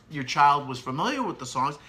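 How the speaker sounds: ordinary talk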